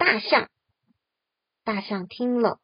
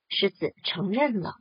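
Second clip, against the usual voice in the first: ordinary delivery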